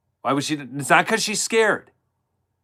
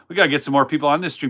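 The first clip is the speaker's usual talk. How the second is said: normal talking voice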